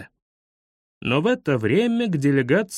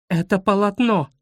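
higher-pitched exasperated insistence; indignant; 5 semitones higher than usual. A narrator's reading. Guide quarrels with pupils, indignant insistence.

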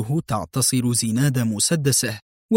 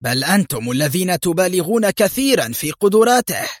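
angry retort, pitched high; higher voice, indignant counter-accusation; narrated anger rising in pitch; 6 semitones higher than usual. A narrator's reading. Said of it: "أجابه موشيه في غضب".

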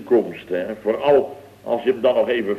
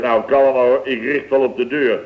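normal talking voice

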